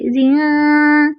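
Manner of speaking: जिन held long, vowel from the side of the mouth; drawn-out जिन. Shown as a demonstration. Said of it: "ज़ेर मुंह की side से".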